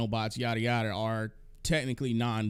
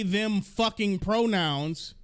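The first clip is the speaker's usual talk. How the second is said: slow, loud, pitched-up sneer; scornful emphasis; 7 semitones higher, 5 dB louder, slower than usual. Real, not acted. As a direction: lean hard into the scorn. Mocking the pronoun debate.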